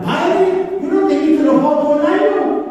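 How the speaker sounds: high, light sister's voice, kept gentle; imitating the sister. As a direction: echo the nun's surprised remark. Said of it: "y me dijo la hermana". (imitando a la hermana con voz aguda y ligera)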